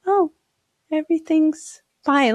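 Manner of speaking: slow, high, airy relief; calm realization. Acted. Nervous system once calmed down, serene relief. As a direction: let it float out, everything settled. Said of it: "when you calm it down".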